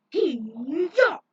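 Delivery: sharp high fighting yell; battle cry kiai. Acted. Fighter attacking, loud sharp battle cry. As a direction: belt out a martial-arts cry.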